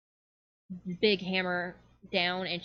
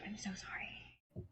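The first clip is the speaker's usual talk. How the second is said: whispery, much softer apology; hushed apology; 15 dB quieter than usual. Acted. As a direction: shrink down to a near whisper. Flustered fairy finishing her apology.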